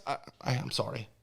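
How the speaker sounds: low, soft, choked-up apology; close to crying. Real, not acted. Fan apologizing mid-story about his team, near tears. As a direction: voice sinks as tears threaten. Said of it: "I might cry".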